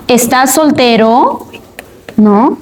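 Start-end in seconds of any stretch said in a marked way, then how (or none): 0.1-1.5 "estás soltero" slowly, climbing sharply on "soltero"; rising question intonation. Shown as a demonstration. Teacher showing students a yes-or-no question, "estás soltero" rising at the end. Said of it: "tiene una entonación ascendente".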